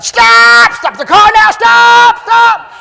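high, loud urgent shout. Retold or imitated. Preacher acting Wigglesworth shouting at his driver to stop, loud. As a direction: yell it like an emergency. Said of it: "Wigglesworth shouted".

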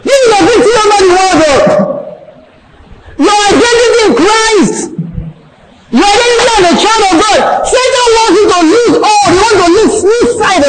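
high, fast, fervent preaching; rising sermon fervour; preaching at full force. Acted. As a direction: lift the voice and drive it home to the congregation. Preaching climax.

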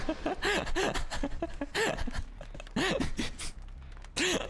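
long, breathless laughing fit; wheezy, unvoiced giggling fit; helpless laughter. Real, not acted. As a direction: break into helpless laughter after a rant. Breaks up after raging at Andy.

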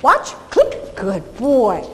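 cue the dog, then praise him. Shown as a demonstration. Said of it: "did you notice that he turned his head".

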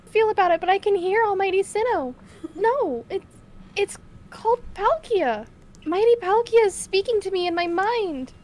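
voicing a clan leader. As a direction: speak as the devout leader hearing his god. Reads Adaman's lines aloud.

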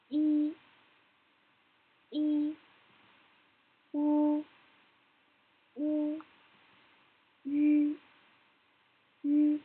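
high, level syllables with pauses between; pronunciation drill. Shown as a demonstration. Teacher models pinyin sounds for learners, high level drill. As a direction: recite each sound evenly on a held high pitch.

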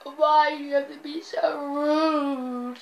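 slow, drawn-out pretend wail; mock crying. Fouled player crying on the floor, mock wailing. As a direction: sob it out, hammed up and stretched. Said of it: "he starts crying on the floor".

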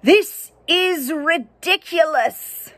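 slow, high, exasperated narrator voice; frustrated narrator. Acted. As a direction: drag it out like a fed-up narrator. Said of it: "the narrator is frustrated".

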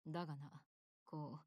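low, soft and halting; hesitant admission. Acted. Confiding discomfort to the commander, hesitant and low. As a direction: drop the voice and feel for the words.

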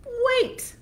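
high, loud panicked wait; frightened goat. Acted. Middle goat stopping the troll, high alarmed cry. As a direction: blurt it in alarm.

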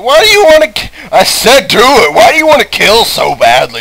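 high, loud mock whine; mock Rambart. Player mocks Rambart begging to know why he kills. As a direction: exaggerate his pleading question. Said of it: "Rambart is like".

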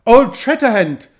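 a cry of joyful astonishment; joyfully surprised cry. A narrator's reading. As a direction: burst out with delighted surprise. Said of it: "rief Knox aufs Freudigste überrascht".

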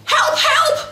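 high, frightened cry for help; rabbit's cry for help. A narrator's reading. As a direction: squeal for help like a scared rabbit. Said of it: "roept knien". (hoge, angstige hulproep)

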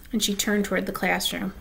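usual speaking voice

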